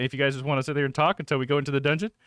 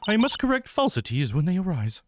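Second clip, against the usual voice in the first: higher, prim, mannered testimony; prim witness voice; 10 semitones higher than usual. Acted. Witness testifying before the court, in a higher prim voice. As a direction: play the composed spirit-medium witness.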